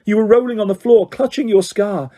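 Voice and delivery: airy fortune-teller lilt, only hinted; Trelawney. A narrator's reading. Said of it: "said Professor Tre-, Trelawney".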